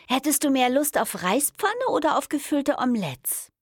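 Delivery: high, chirpy dinner question; deliberately cheerful. A narrator's reading. Woman asking her partner about dinner, pointedly cheerful. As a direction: keep it high and determinedly cheerful. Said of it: "sagte ich betont heiter".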